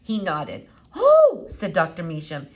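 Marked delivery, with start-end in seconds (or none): 1.0-1.5 very high, loud exclamation; delighted oh. A narrator's reading. Doctor delighted the squirrel nods, very high. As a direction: gasp with delight.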